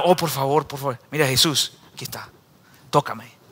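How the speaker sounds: a hushed desperate whisper of a plea; soft pleading. Acted. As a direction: plead quietly to Jesus. Blind man pleading softly.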